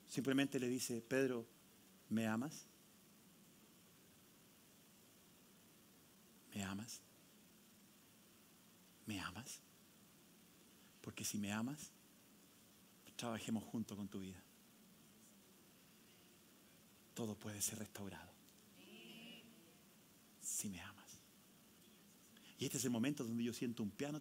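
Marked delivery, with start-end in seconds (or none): 1.0-9.6 soft, much quieter gentle asking; tender question. Acted. Jesus softly asking Peter if he loves him, tender. 11.0-21.2 much quieter, lower pleading; soft intimate preaching. Acted. Pastor tenderly urging the congregation, hushed.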